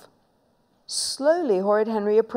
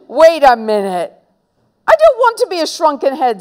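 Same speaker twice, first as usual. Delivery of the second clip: fast, high, louder protest; alarmed protest; 8 semitones higher, 6 dB louder than usual. Acted. Little brother backing away from the shrinker, fast high protest. Frightened brother backs towards the door.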